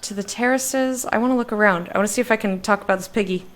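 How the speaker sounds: usual speaking voice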